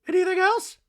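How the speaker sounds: very high, loud squeaky voice; hammed-up high Dorit voice; mock Dorit. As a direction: clip it like a curt diva. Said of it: "Dorit's like".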